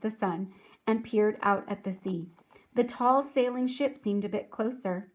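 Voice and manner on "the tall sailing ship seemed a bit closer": ordinary delivery, nothing marked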